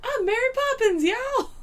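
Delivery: high, whooping movie quote; hammed-up joyous exclamation; gleeful proclamation. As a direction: crow it proudly way up high. Quotes Yondu's film line.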